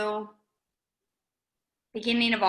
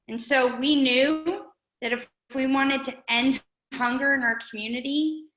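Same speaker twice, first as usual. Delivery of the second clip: ordinary talk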